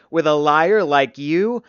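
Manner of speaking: loud, drawn-out scornful question; snapping. A narrator's reading. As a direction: throw it back loud and slow. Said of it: "Dane snapped".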